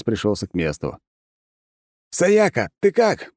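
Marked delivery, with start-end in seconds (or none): 2.1-3.3 high, loud yell across the battle; yelling at full voice. Acted. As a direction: shout at the top of your lungs. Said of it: "заорал я во всю глотку".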